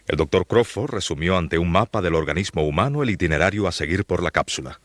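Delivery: ordinary delivery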